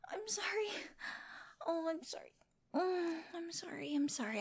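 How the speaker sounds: soft, remorseful apologies; hushed and consoling; tender apology. Acted. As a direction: apologise gently to someone in tears. Comforting someone who starts crying.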